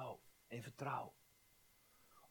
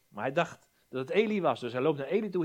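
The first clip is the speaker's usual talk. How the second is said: ordinary talk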